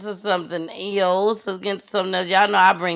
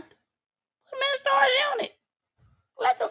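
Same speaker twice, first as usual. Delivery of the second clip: high mock exclaim; 5 dB quieter than usual. Real, not acted.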